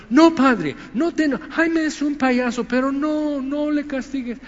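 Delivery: high, loud whiny plea to a father; hammed-up begging in a high voice; mock pleading child. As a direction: beg like a kid covering for a clown friend. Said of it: "Está diciendo".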